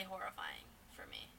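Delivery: hushed, unvoiced whisper. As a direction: whisper it with dread. Whispered spooky recollection.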